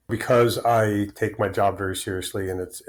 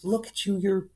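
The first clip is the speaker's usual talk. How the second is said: higher, lightly voiced scolding wife; a hint of his wife's alarm, higher; 10 semitones higher, 4 dB quieter than usual. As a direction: raise the pitch to play his wife fretting. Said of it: "And she goes".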